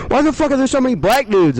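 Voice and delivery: high, loud incredulous shout; mock outrage. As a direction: shout it in hammed-up disbelief. Acted visitor's outraged question.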